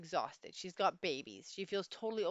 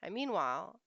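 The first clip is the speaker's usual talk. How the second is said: ordinary delivery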